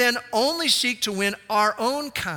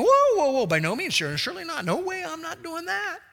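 mock protest; 9 semitones higher than usual. Believers balking at God, mock alarmed protest. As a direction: balk like someone asked too much.

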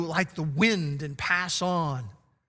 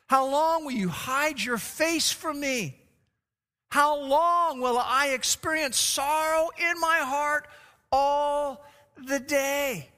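slow, higher plaintive plea to God; slow pleading lament; 6 semitones higher, slower than usual. Acted. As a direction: draw out each "How long" like an aching prayer. Preacher voicing David's lament.

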